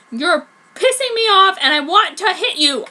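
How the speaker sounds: loud angry threat; furiously. A narrator's reading. Heroine threatens to hit Kishu, furious. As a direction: push the threat hard. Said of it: "I said furiously".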